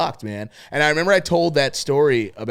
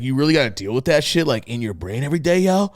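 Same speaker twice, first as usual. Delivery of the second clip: incredulous imitation. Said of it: "he said it like that".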